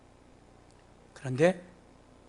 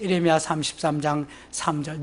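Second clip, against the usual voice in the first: normal talking voice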